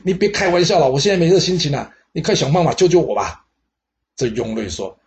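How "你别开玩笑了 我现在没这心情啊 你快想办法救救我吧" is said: much higher and louder, agitated and pleading; frantic pleading. Acted. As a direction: cut off the joke and beg, panicking. Lover frantic at advisor's joke.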